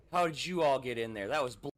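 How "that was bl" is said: normal talking voice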